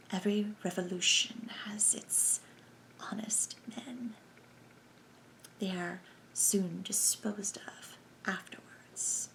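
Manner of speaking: slow, soft and sinister; menacing hushed whisper. A narrator's reading. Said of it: "There was a sinister note in his voice".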